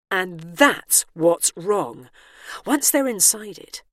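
usual speaking voice

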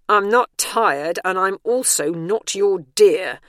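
loud, slow, emphatic retort; indignant retort. A narrator's reading. Bernice retorts to the guarding operative, loudly indignant. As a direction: push back firmly on every word.

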